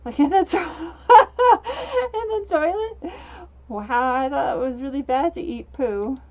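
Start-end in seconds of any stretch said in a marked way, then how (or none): 0.1-3.1 high, loud, cracking up mid-question; words breaking into real laughter; laughing. Real, not acted. Cracks up reading game dialogue.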